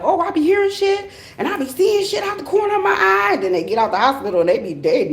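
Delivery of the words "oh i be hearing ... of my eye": much higher, put-on voice of a spooked relative; mocking relatives. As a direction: imitate a family member hearing things. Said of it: "in there like".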